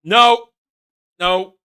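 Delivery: loud, high exasperated no. Real, not acted. Reviewer shouting at the scene, loud exasperated no. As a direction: shout it in disbelief.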